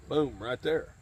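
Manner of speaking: ordinary delivery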